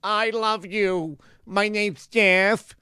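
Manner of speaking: hammed-up childish yes-man; mock obedient child. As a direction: play the meek little kid who swallows everything. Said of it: "We're going".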